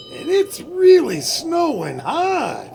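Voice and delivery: ordinary talk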